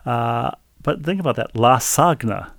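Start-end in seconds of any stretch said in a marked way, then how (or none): 1.6-2.5 "La Sagna" as la SAG-na; hard G in lasagna. Shown as a demonstration. Host mulling over the emailer's pronunciation, hard G in La Sagna. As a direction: split it into La Sagna with G sounded. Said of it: "pronounce the G".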